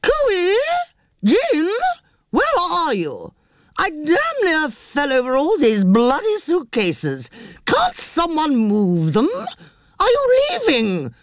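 high, slow calling up the stairs; yelling across the room. Acted. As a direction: call out upstairs in a high voice. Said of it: "A voice called up the stairs".

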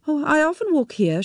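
high, light evasive reply; brushing the question off; evasive woman. A narrator's reading. She answers Tim, high and evasive.

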